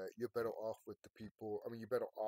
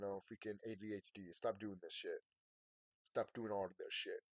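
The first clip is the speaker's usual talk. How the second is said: ordinary delivery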